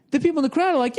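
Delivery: ordinary talk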